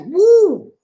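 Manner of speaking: high, loud amazed whoop; amazed woo. Real, not acted. Host amazed at the Tesla's acceleration. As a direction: whoop at the number. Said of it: "That is fast".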